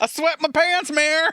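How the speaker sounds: high, loud mock yell; hammed-up loud shout. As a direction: belt it out like a cheap joke. Mock shouted line to the mayor.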